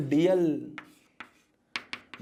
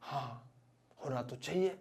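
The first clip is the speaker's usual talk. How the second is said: small, soft, reluctant admission; imitating a shaken man quietly conceding; mock trembling reply; 3 semitones lower, 8 dB quieter than usual. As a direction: give in meekly, barely voiced. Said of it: "कांपते कांपते बोले कि".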